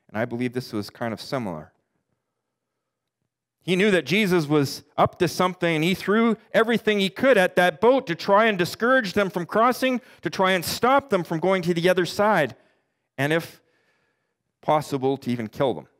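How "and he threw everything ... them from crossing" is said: usual speaking voice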